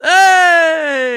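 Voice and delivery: loud, high whoop of cheer; cheering shout. Real, not acted. Friend hyping his buddy's new trainer, loud cheer. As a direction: yell it out to hype a friend.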